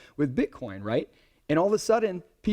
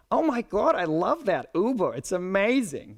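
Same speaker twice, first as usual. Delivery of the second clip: higher, delighted mother-in-law gush; 6 semitones higher than usual. Retold or imitated. Mother-in-law gushing to her son-in-law about Uber, higher and delighted. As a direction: rave like a thrilled mother-in-law. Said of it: "That's how your mother-in-law is gonna sound".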